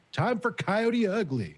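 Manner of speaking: high, slow, gleeful announcement; excited. Acted. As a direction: call it out with a laugh. Announcing in character.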